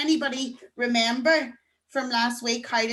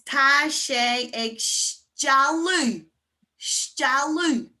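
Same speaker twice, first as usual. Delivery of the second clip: drawn-out STEALÚ with a long opening sh; slow, stretched teacher's model; slow demonstration; 3 semitones higher, much slower than usual. Shown as a demonstration. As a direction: model the new phrase slowly for learners. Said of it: "another way to say it's lashing".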